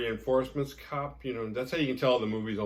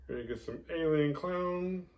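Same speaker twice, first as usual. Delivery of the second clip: mocking alien clown; 8 semitones higher than usual.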